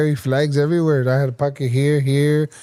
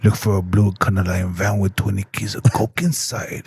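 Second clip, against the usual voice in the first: Arnold impression; 6 semitones lower than usual. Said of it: "That's Arnold".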